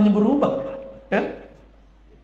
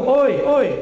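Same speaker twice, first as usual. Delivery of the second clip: higher, louder oi calls; calling out far; 8 semitones higher, 4 dB louder than usual. Shown as a demonstration. Preacher showing how you call to someone far away, loud and high. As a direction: call out oi across a distance. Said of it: "Kalau jauh".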